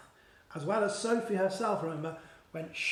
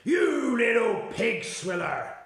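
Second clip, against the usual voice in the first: very slow, louder roar; roaring giant; 4 semitones higher, 7 dB louder, slower than usual. Acted. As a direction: bellow the insult with fury. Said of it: "roared the Blood Butler".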